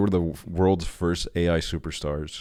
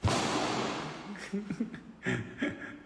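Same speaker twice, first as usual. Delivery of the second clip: long breathless laugh; 14 semitones higher, 11 dB quieter than usual. Real, not acted. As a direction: burst out laughing. Laughs hard at a video.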